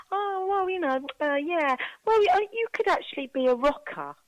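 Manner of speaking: high-pitched, hesitant suggestion; imitating the girls. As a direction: float a tentative idea in a high voice. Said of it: "even went".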